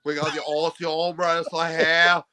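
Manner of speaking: hammed-up, wobbly Australian accent; Aussie impression. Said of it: "All right, do me".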